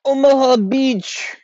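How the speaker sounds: Omaha Beach drawn out high and slow in a put-on accent; odd mock accent. Shown as a demonstration. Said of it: "I don't know what that accent was".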